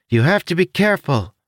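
high, odd little creature voice; chirpy winged creature, helpful. Acted.

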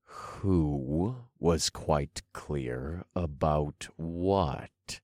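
slow, low-volume demand. Acted. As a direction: press for the answer word by word, quietly. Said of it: "I demanded".

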